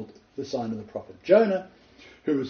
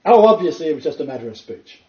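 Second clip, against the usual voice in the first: loud, higher, insisting it away; loud insistence; 4 semitones higher, 11 dB louder than usual. Retold or imitated. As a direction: push the excuse out loudly. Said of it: "He tried to reason it out loudly".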